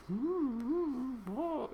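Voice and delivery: very high, camp humming; sing-song mock of a camp prisoner; mincing prisoner impression. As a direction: hum it high and prissy like the camp inmate.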